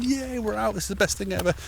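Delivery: high, bouncy puppy glee; excited dog voice. Retold or imitated. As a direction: voice the dog's giddy joy.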